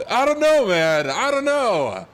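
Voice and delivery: high exasperated disbelief. Real, not acted. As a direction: throw up your hands in disbelief.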